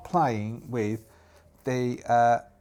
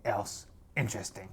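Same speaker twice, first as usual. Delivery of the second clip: put-on captain's voice standing down; conceding pirate captain; 3 semitones lower than usual. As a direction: call the lads, then back down steadily.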